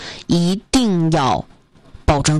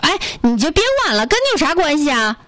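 higher, fast, flustered brush-off; 7 semitones higher, faster than usual. Retold or imitated. Cornered scammer brushes off the brother, flustered and fast. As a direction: panicky mock snap to get rid of him. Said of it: "非常慌张了".

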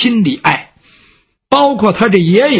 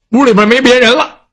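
ordinary delivery

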